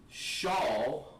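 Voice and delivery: "Shal" as one long, drawn-out syllable; pronunciation demo. Shown as a demonstration. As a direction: model "Shal" slowly and clearly for the class. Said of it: "is how you say of".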